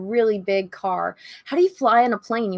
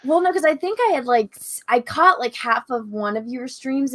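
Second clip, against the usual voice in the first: ordinary talk, nothing marked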